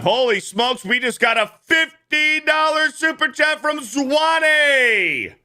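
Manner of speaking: thrilled slow shout of the fifty dollar chat; excited. Real, not acted. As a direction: celebrate the donation, drawn out. Big donation arrives.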